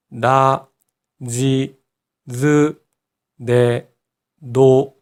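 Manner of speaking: 나, 지, 즈, 네, 노 as voiced da, ji, zu, de, do; voiced d row. Shown as a demonstration. Teacher has learners listen first, voiced da, ji, zu, de, do for 나, 지, 즈, 네, 노. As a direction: separate syllables with voiced d and z onsets. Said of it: "치읓은 지읒으로 바뀌는 특징이 있어요".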